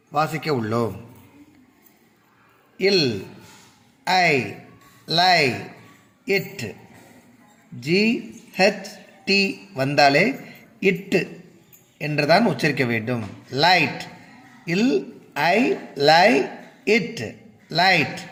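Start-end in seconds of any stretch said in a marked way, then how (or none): 2.8-6.8 LIGHT broken into இல், ஐ, லை, இட்; phonics sounding-out. Shown as a demonstration. Teacher reading simple English words by phonics, sounding out. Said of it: "அந்த உச்சரிப்பின் மூலமாக". 14.7-17.4 LIGHT built from இல், ஐ, லை, இட்; phonics sounding-out. Shown as a demonstration. Teacher repeating the word's parts for learners, sounding out. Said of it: "அந்த உச்சரிப்பின் மூலமாக".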